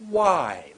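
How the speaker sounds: WHY loud, long, sliding down; full intonation. Shown as a demonstration. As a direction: stretch WHY and let it fall. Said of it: "I got intonation".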